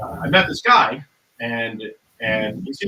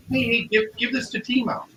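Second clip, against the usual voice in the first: put-on raised pitch for the girl's line; lightly voiced sly woman; 7 semitones higher than usual. Retelling a girl handing over a pill, lightly imitated higher voice. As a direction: imitate her coaxing, higher than your own voice.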